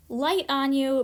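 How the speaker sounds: normal talking voice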